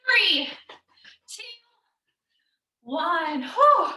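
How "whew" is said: puffing high whew after the set; tired loud whew. Real, not acted. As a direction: blow out hard, spent. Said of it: "I was very tired".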